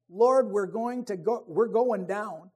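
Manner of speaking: loud, frightened cry to be saved; panicked call for help. Retold or imitated. Disciples crying out to Jesus in the storm, loud panicked call. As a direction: call out like the boat is sinking. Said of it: "So they call out to Jesus".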